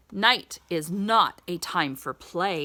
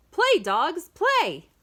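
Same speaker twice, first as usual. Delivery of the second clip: higher, slow, drawn out call; cheering; 8 semitones higher than usual. Acted. Reading to a child, cheering the dogs to play.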